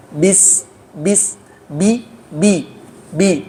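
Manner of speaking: "bis, bi" with no puff of air from the lips; correct ba. Shown as a demonstration. Teacher drills the correct unaspirated "bi". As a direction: close the lips and release "bi" without breath. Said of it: "tidak ada tiupan napas".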